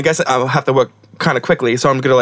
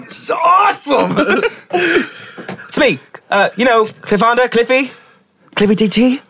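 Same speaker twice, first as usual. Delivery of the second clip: excited; 10 semitones higher than usual. Real, not acted. Player excited in character.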